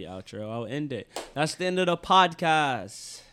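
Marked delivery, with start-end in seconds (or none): none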